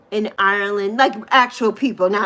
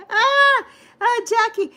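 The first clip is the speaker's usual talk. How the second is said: laughing exclamation; 11 semitones higher than usual. Real, not acted. High laughing reaction to Jackie.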